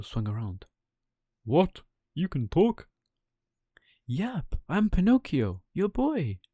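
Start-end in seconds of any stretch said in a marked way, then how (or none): 1.4-2.9 startled, loud and high; shocked. Acted. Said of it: "said Geppetto in shock". 4.0-6.4 put-on high, bright puppet boy voice; a wooden boy calling out proudly; Pinocchio voice. Pinocchio voice again.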